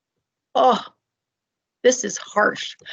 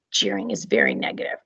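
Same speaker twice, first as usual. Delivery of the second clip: whisper; 6 dB quieter, faster than usual. Acted. Teacher whispering to stress how negative jeering is. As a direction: lean in and whisper it.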